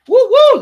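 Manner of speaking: high, loud woo-woo; quick celebratory whoops; excited whoop. Real, not acted. Cheering before the prize draw.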